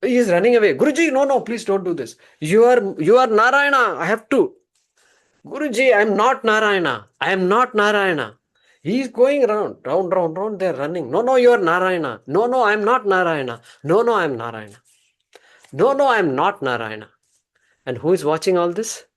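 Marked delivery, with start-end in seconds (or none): 0.7-4.5 much higher and louder, panicked; high panicked protest; frantic pleading. Acted. Barber begs guru to stop. 5.5-8.4 higher, louder insistent no; pleading high denial; urgent denial. Acted. Barber denies being Narayana. 11.1-17.1 excited back-and-forth. Acted. Comic chase, both insisting.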